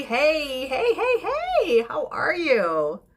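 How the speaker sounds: high squealing heys with a laugh; bubbling over with glee at a viewer; greeting a Maryland viewer with laughing squeals; excited hey hey. Real, not acted.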